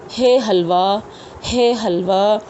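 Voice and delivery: हे हलवा with a breathy throat h. Shown as a demonstration. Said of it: "जैसे उर्दू में हम पढ़ते हैं ना". (हे हलवा, सांस की आवाज़ के साथ)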